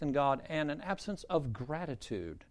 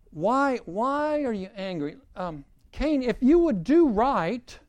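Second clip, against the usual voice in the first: high, slow, drawn-out appeal; insistent question, rising louder; God questioning Cain; 7 semitones higher, 5 dB louder, slower than usual. Acted. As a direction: plead the point, stretching and lifting key words. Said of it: "God is asking Cain".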